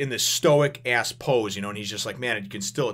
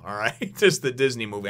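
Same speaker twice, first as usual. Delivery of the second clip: ordinary delivery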